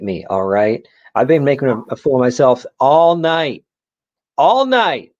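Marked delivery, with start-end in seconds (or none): 1.2-5.1 defiant outburst. Acted. Character boasts, voice rising.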